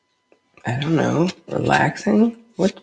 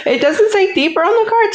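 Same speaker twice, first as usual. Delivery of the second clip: laughing apology. Real, not acted. Wife laughing as she apologizes for misreading card. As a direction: giggle out the sorry.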